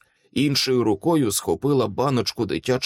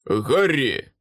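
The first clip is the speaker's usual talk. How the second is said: mildly raised warning cry; warning shout. A narrator's reading. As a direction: call the name out in alarm, moderately. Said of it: "прокричав Майкл". (застережливий вигук)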